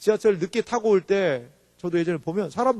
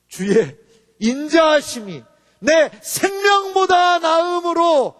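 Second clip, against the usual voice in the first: high, forceful pulpit declamation; fervent sermon delivery; impassioned preaching; 8 semitones higher, 5 dB louder, slower than usual. Real, not acted. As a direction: proclaim it, stretching the key words.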